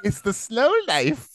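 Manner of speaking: high, drawn-out female character impression; mock movie line. Imitating a woman's movie line.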